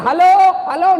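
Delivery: loud, high shouted hello; mock phone yell. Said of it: "Dia teriak".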